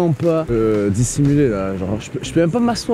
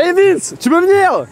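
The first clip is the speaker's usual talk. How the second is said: high calling voice; 13 semitones higher, 4 dB louder, slower than usual. Pretend. Calling out to the seeker.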